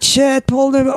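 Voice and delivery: startled recognition. Retold or imitated. Recognising Paul Newman.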